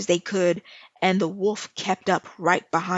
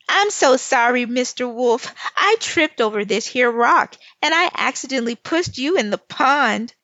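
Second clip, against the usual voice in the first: put-on higher, louder little girl, all innocence; sweetly fake apology; 4 semitones higher, 5 dB louder than usual. Lil Red feigning sorry to the wet wolf, higher and louder. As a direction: play the sly child pretending to be sorry.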